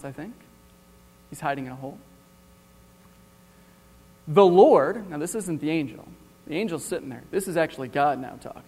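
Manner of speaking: ordinary talk, nothing marked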